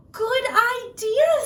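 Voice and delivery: put-on high, slow and loud happy girl; delighted little girl agreeing. Little Red voiced.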